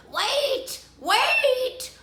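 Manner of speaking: high, stretched frog call; pleading high-pitched call. A narrator's reading. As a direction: call after someone running away. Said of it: "called the frog".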